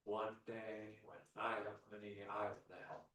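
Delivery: soft, low, acted daydream; wistful someday voice. Imitating someone putting off service until money comes, wistful someday voice. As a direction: trail along quietly with long pauses.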